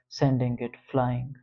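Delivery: ordinary delivery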